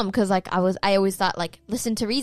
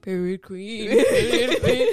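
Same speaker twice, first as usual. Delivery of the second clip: mock homage; much slower than usual. Brother bowing down to teased sister, imitated mock homage. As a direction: chant it as teasing homage, breaking into laughter. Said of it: "mimicking bowing down to you".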